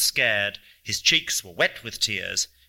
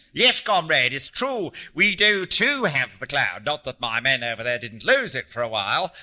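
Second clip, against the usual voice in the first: put-on scornful old man in a lively tirade; ranting, mocking Hanlon; 3 semitones higher, 4 dB louder than usual. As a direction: act out pompous leaders with emphatic, sarcastic punch. Voices Hanlon's mocking rant.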